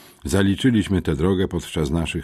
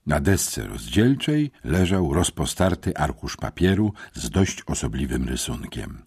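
ordinary delivery

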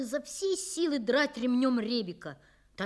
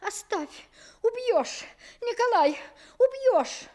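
much higher, loud panicked pleading; frightened cries; 11 semitones higher, 6 dB louder than usual. Acted. Mother crying out to her husband in fright. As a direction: cry out to stop him from killing the dog. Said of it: "Мама только вскрикивала".

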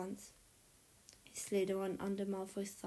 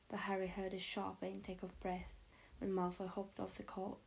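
ordinary delivery

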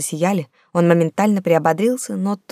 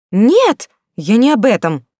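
high embarrassed cry, louder; exclaiming; 6 semitones higher, 6 dB louder, slower than usual. Acted. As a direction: blurt it out, flustered. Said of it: "воскликнула я краснея".

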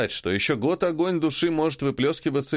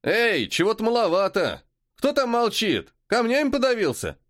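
distant shout; 10 semitones higher than usual. Acted. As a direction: call out across the caves. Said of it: "Раздался крик".

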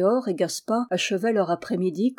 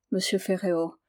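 ordinary delivery, nothing marked

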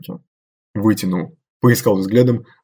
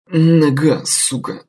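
pain-squeezed curse, dropping to breath; strained in pain; 6 semitones higher, much slower than usual. Acted. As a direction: force it out through pain. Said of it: "выдавил ирландец".

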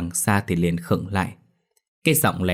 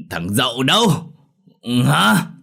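put-on loud, higher drunken bark; angry demand; 5 semitones higher, 4 dB louder, slower than usual. A narrator's reading. Drunk father cracks whip and demands his son, angrily. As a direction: snap the question at them.

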